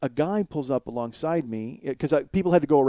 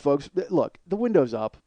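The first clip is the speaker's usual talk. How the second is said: ordinary talk, nothing marked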